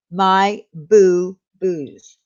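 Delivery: each word drawn out slowly; slowly repeating My Boo Boos so viewers catch the name; slow and clear. Shown as a demonstration. Repeating channel name for chat.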